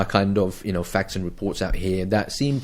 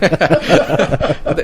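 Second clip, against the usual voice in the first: high, loud burst of laughter; real laugh after a cheeky jab; 7 semitones higher, 6 dB louder than usual. Real, not acted. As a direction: crack up at your own joke. Laughing at his own joke.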